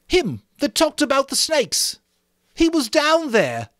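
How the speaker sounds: put-on high, loud, breathless boy; gasping Piggy. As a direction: gasp it out, high. Piggy voiced in reading.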